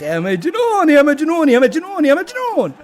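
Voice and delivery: high, loud repeated cheers; ecstatic shouting. Real, not acted. Fan hailing the crazy player after the goal, ecstatic shouting. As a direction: yell in delirious admiration.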